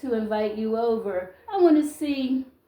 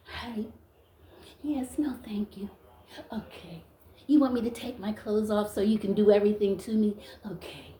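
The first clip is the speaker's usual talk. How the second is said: hushed, mock-demure little replies; meek, submissive woman; 5 dB quieter than usual. Strong woman mocking what men want, meek and hushed. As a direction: play it tiny and obliging, near a whisper.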